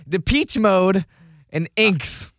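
DEPECHE said as "the peach", INKS as one syllable; botched band names. Retold or imitated. Trivia host replaying last night's misreading, botched names. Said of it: "You pronounce them how you pronounced them last night".

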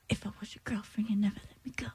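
breathy whispered song line; whispered lyric. Pretend. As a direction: whisper the lyric, half sung.

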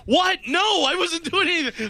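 high, frantic protest of innocence; panicked denial. Retold or imitated. Caught burglar pleading with the neighbor, high and frantic. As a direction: squeak out a desperate excuse. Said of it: "Guy goes".

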